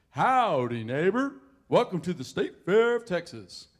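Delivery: big booming loud cowboy welcome; Big Tex greeting. Retold or imitated. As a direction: boom it out like a giant fairground cowboy. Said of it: "Big Tex says".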